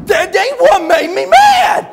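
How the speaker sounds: slow, high, furious mock bellow; hammed-up tongue-tied roar; Buddy. Buddy corrects the preacher, bellowing in worked-up rage. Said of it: "when he gets real worked up, he can't talk very good".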